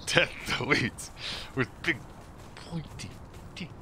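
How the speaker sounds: a soft lingering hint of the wizard; lightly voiced wizard. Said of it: "watch Holy Grail".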